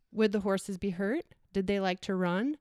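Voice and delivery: usual speaking voice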